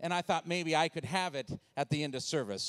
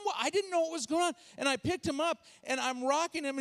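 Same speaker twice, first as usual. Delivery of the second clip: ordinary delivery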